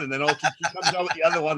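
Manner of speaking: usual speaking voice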